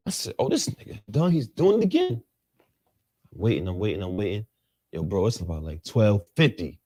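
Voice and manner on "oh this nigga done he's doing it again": higher-pitched mock disbelief; lightly hammed-up exasperation; retold exasperation. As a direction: act out fed-up waiting, voice climbing. Retold exasperation waiting for his song.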